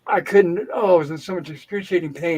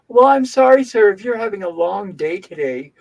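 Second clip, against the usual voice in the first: condescending nurse; 2 semitones higher, 5 dB louder than usual. Retold or imitated. Nurse to a patient who waited too long, mock condescending. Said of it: "all condescending".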